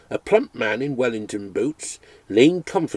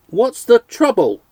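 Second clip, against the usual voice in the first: loud, high, slow shout to a deaf man; full-blooded shout; 11 semitones higher, 8 dB louder than usual. Acted. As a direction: bellow each word so he hears. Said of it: "with a full-blooded shout".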